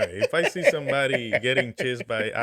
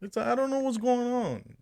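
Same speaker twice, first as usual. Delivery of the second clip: whiny high voice; 12 semitones higher, 5 dB louder than usual. Pretend. Mock whiny eye complaint.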